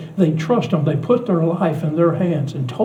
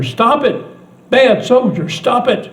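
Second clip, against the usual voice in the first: put-on upset child yelling; 7 semitones higher than usual. Granddaughter shouting at the stage soldiers, high angry cry. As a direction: order the soldiers to stop, outraged. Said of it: "terror and anger".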